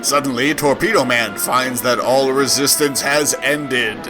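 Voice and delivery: usual speaking voice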